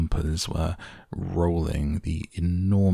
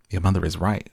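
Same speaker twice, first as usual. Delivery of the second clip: plain reading voice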